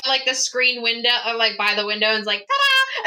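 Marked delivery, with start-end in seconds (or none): 2.4-3.1 high, playful ta-da flourish; mock grand reveal, pitched way up; showy ta-da. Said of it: "she's doing it by".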